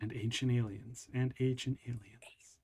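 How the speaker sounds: low, very soft, dropping into a whisper; playful hushed aside. Pretend. Co-host slipping in a joke after the plug, hushed. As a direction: murmur it, then whisper it slowly.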